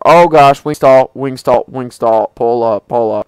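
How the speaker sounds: loud urgent yelling; panicked shout. Real, not acted. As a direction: shout the stall warning. Stall warning panic.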